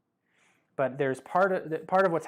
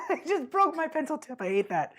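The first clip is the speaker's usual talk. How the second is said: laughing dismay; 14 semitones higher than usual. Real, not acted. Drawing teacher tells viewers of the mishap, laughing dismay. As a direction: laugh through the complaint, voice pitched way up. Laughs over broken pencil tip.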